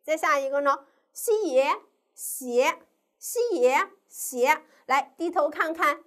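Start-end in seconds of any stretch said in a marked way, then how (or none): 1.1-4.6 西耶 slowly in parts, then blended into 斜; syllable blending demo. Shown as a demonstration. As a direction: sound out 西耶, pause, then 斜 as one syllable. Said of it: "我们可以怎么拼呢".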